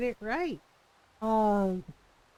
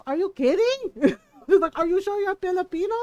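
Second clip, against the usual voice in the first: higher, louder teasing mom voice; mock mom; 6 semitones higher, 6 dB louder than usual. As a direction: scoff like a mom in disbelief. Mom teasing daughter in story.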